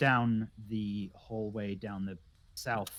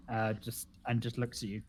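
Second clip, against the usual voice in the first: ordinary talk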